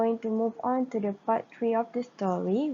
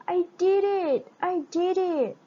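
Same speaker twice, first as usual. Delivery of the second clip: much higher, excited cry; excited scream; 8 semitones higher than usual. Acted. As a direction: shout with triumphant joy. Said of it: "screamed Gretel with great excitement".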